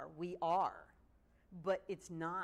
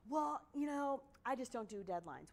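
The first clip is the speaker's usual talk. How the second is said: mock excuse; 6 semitones higher than usual. Lecturer mimicking a client dodging deadlines, mock whine. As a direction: mimic a breezy excuse-maker, pitched up.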